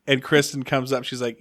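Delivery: usual speaking voice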